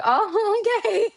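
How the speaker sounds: very high-pitched uneasy acknowledgment; awkward, high little mock okay. [name]'s wary reaction to her.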